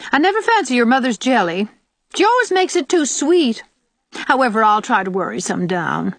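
put-on high, complaining old woman; sour old aunt. Old aunt to Diana about her mother's jelly, sour. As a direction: find fault with the gift, high and grudging.

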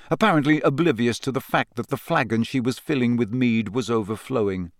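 usual speaking voice

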